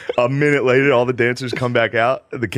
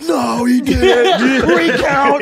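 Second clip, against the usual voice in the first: high, slow, loud protest; hammed-up crowd shouting back; mock outraged crowd; 5 dB louder, much slower than usual. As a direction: yell it like an angry rally crowd. Arizona crowd voiced in protest.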